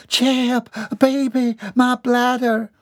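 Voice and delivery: high, pleading country wife; urgent. A narrator's reading. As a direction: press your husband urgently, pitched high. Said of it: "Overhearing the urgent voice".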